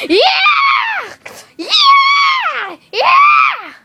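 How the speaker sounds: high, shrieked party cheers; screaming yeahs at a high pitch; wild party cheer. Acted. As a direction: whoop at full force like a rowdy partygoer.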